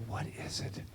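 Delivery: breathy whispered question to himself; a quiet aside on a walk, barely voiced; hushed to himself. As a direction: whisper it as if no one should hear. Asking himself on the path.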